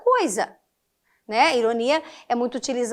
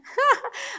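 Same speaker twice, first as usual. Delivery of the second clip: short laugh; 11 semitones higher, 4 dB quieter than usual. Real, not acted. Teacher laughs at her example.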